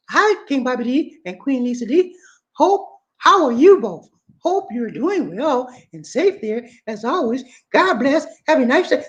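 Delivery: lady's voice. Shown as a demonstration. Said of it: "I'm sorry, wrong voice".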